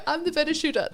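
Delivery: playful boast. Retold or imitated. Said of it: "And, like, you know".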